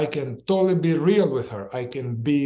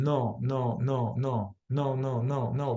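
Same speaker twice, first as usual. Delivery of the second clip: softer run of quick repeated no's; rapid fire; 3 semitones lower, 4 dB quieter, slower than usual. Shown as a demonstration. Facilitator demonstrating an inner command's refusal, rapid-fire. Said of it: "rapid fire this into the contraction".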